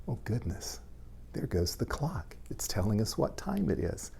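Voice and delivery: playful hushed whisper. Pretend. Reading to children, pretends the clock is talking, whispered. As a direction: whisper it like a secret to the kids.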